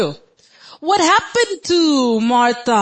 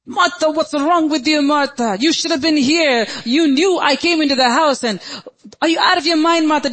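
mock upset rant, higher pitched; frustrated scolding; 6 semitones higher, 3 dB louder than usual. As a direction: scold her in hammed-up exasperation. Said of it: "Do you see Jesus talking like that? Full of frustration".

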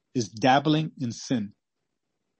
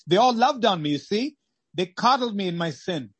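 high anguished cry; 7 semitones higher, 3 dB louder than usual. Acted. Voicing a soul in hell.

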